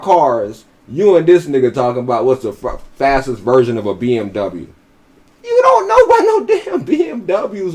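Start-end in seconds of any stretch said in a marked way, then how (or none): 5.4-7.8 laughing mockery. Real, not acted. Mocking him about cars, laughing.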